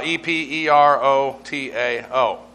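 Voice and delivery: "E P E R O T A O" spelled slowly, letter by letter; spelling out eperotao. Shown as a demonstration. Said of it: "but you have eperotao".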